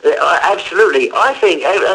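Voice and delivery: ordinary delivery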